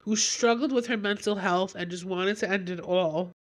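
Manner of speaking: ordinary talk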